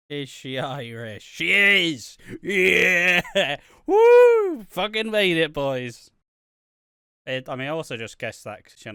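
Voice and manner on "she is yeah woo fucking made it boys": triumphant cheer. Real, not acted. Guessing right about Irish name.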